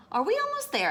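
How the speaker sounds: high-pitched little hen question; asking hen. A narrator's reading. Hen asking the fox on the trail, high-pitched.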